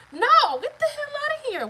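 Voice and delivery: high, loud, indignant rejection; outraged dismissal. Retold or imitated. Telling ex off over his lame excuse, outraged.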